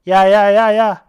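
loud, rapid-repeat interruption; cutting in. Acted. Friend shutting down a protest, loudly cutting in. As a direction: cut them off loudly with each word.

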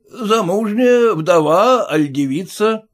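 blunt merchant scoffing at formality; gruff merchant. Acted. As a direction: push in like the owner. Merchant questions.

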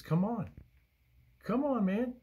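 high-pitched, encouraging beckoning, pitch climbing; coaxing parent. Acted. Jesus calling Peter onto the water, coaxing like a parent. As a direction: coax them over like a toddler learning to walk. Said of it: "what we do with our kids".

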